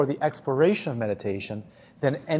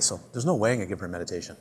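ordinary talk

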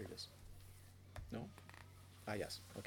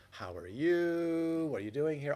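mock chatty greeting; 4 semitones higher, 7 dB louder than usual. Chatty meditator in the bathhouse.

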